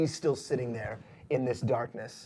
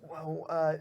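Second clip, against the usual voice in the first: higher-pitched, faltering lackey voice; apologetic underling, voice raised in pitch; nervous henchman; 6 semitones higher than usual. Acted. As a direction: stammer excuses to the boss, pitched up. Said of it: "and, uh, he goes".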